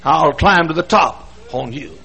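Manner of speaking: high, loud defiant preacher cry; full-force preaching. Real, not acted. Preacher urging listeners to defy problems, loud. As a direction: defy your troubles out loud.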